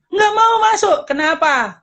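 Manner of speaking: put-on a small kid refusing to go in; whiny child. (rengekan anak kecil yang lebih tinggi dan keras)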